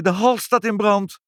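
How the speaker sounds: high-pitched urgent alarm; alarmed cry. A narrator's reading. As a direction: call out the emergency, pitched high. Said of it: "riep Ibbeltje". (hoog en gealarmeerd roepend)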